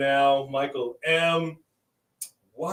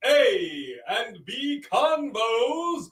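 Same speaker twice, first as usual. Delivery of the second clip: put-on royal proclamation accent; 9 semitones higher, much slower than usual. Hailing the king and queen of YouTube, royal proclamation accent. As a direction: proclaim it like a hammed-up town crier. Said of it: "I know what this accent is".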